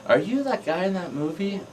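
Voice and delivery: high-pitched fan's question; curious. Retold or imitated. Filmgoer asking the actor, curious and high-pitched. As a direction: voice a curious fan far above your own pitch. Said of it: "kinda curiously".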